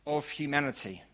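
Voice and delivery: ordinary talk, nothing marked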